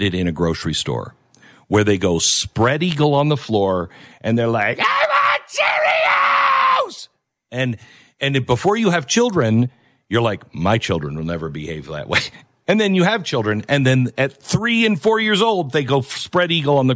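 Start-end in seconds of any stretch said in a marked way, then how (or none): 4.8-7.1 very high, screamed imitation of a tantruming child; mock toddler tantrum. Host imitating a child spread-eagle in the grocery store, tantrum scream. 10.5-12.2 lightly put-on lower, measured voice; quietly certain, as a mock would-be parent; mock self-assured parent. Host voicing someone before having children, lightly mocked low assurance.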